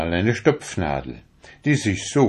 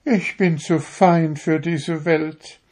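high, proud little needle voice; a needle showing off how fine it is; vain darning needle; 9 semitones higher than usual. A narrator's reading. To the fingers, a vain needle in a high proud voice. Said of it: "sagte sie".

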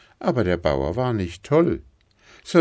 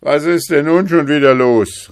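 loud, high shout from above; calling down; 10 semitones higher, 10 dB louder than usual. A narrator's reading. Old king at the window demanding an explanation, calling down. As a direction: holler down to the courtyard. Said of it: "rief er herunter".

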